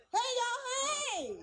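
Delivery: high, loud Southern-style holler; hollered greeting. Acted. Comic greets the crowd like she's from the South, hollering. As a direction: holler it, stretching the last word.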